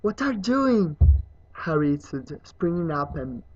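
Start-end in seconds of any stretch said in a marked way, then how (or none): 0.0-1.0 higher, loud, urgent protest; startled, raised voice; alarmed cry. Acted. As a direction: call out to stop someone in alarm. Boy stopping self-punishing elf.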